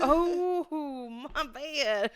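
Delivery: high, drawn-out oh breaking into laughter; sheepish mock apology. Woman retelling being shown her keys, sheepish mock apology. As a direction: own up with a hammed-up wail.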